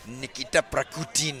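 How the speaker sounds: put-on a light Russian accent, low and soft like a spy's name. Imitating co-host saying Nikita Prakutin in a Russian accent. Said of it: "with that Russian accent".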